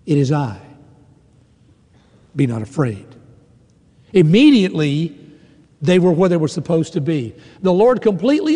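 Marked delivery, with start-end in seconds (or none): 0.1-3.0 calm voice of God. Retold or imitated. God speaking to listeners in their storm, low soothing reassurance. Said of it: "hear his voice".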